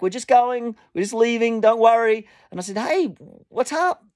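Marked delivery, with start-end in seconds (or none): 0.0-2.3 high call back, moderate volume; lightly mimicked shouted reply; retold shout back. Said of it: "the guy shouted back". 2.8-4.0 high, concerned call from a distance; retold holler, pitched up. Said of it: "I called out to them".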